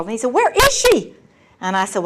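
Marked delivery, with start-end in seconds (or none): 0.3-1.1 high, startled demand; urgent alarm. Retold or imitated. Father learning missing daughter phoned, urgently asking. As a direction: imitate an anxious dad's outburst.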